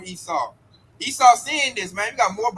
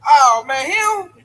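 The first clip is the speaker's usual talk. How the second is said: loud, higher exclamation of recognition; hammed-up gasp of discovery; mock surprise; 5 semitones higher, 7 dB louder, much slower than usual. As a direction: burst out like you just spotted him. Quoted onlookers spotting the man.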